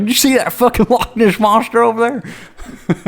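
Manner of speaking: high, loud cartoonish gawker. Normal guy spotting Loch Ness monster, high mocking voice. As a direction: pitch it way up as a silly excited bystander. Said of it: "sounds like I'm talking about normal".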